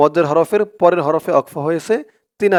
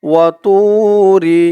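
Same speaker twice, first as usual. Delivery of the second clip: Quran recitation; 3 semitones higher, 5 dB louder, much slower than usual. Shown as a demonstration. Teacher reciting for viewers to repeat, slow tajweed chant. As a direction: recite it slowly so students can follow. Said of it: "recitation demo".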